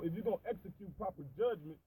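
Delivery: normal talking voice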